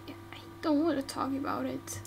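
soft whisper, hurt; whispered refusal. Acted. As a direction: barely say it, closing the subject. Refuses to talk.

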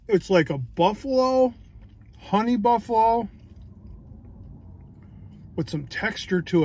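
talking between bites; muffled chewing speech; mouth full of pizza. Real, not acted. Seen 0: Bites pizza at 0.4, then cheeks full, chewing while speaking.

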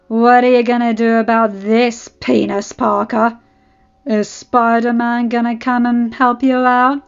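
loud, drawn-out bully's jeer; sneering taunt. A narrator's reading. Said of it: "he sneered".

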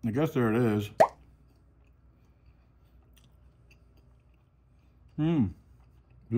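chewing while talking; talking between bites; mouth full. Real, not acted. Seen 1: Lips closed and working after bite; opens to speak by 1.5.

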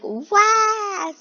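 high, loud mock "wah"; mimicked wail. Viewer imitating players on screen, high loud mock wail. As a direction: swoop up into a long, loud wail. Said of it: "The other one's like".

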